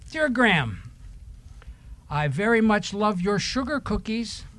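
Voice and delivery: ordinary talk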